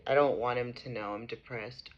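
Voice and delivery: low and soft, drained; quiet. Real, not acted. Mother repeating her wish to viewers, quiet and drained. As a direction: let it sink, worn out. Same words, quiet after rant.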